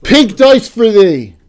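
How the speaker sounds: slow, loud mock-royal proclamation; mock royal decree. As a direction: proclaim it like a herald. Grand mock-formal prize award.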